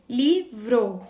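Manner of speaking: livro in two separate beats, li-vro; chunked "Livro", pause between li and vro; split into syllables. Shown as a demonstration. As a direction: break "Livro" after the li. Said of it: "Quantos pedacinhos tem?".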